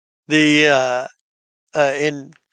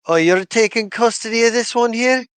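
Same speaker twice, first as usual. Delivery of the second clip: raised, questioning guard voice; Dovry asks, pitched up and curious; Dovry's voice; 8 semitones higher, 3 dB louder than usual. Acted. Dovry questions the player about custody, pitched high. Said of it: "Dovry's like".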